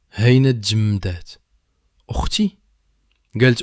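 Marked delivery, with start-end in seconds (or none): 2.1-2.6 high disbelieving "sister?"; can't believe her ears; girl frozen in shock; stunned question. A narrator's reading. Girl hears she has a sister.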